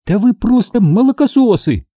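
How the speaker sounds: put-on high-pitched cross girl; angry retort. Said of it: "сердито бросила Минди". (сердитый девчачий выпад)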